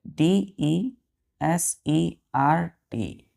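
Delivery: letters spelled very slowly; spelling out. Shown as a demonstration. Teacher spelling the word slowly for the class. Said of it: "desert कितने letter है?".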